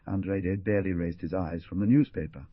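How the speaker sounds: plain reading voice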